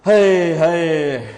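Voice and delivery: long, drawn-out cry of lament; lamenting wail. Acted. Preacher mourns the lost honour of faith, slow lament. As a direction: stretch each word out, sliding slowly down.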